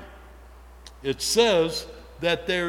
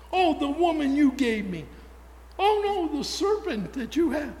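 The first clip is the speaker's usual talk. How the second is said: whiny, much higher voice passing the blame; mock blame game; 9 semitones higher than usual. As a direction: play Adam shifting blame in a high whine. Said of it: "Big blame game".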